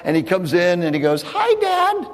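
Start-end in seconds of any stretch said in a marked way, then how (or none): 1.2-2.1 much higher, put-on voice; a son trying to pass as his brother; faked Esau voice. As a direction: Jacob fakes Esau's voice to fool his father. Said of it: "That's not the voice of my Esau".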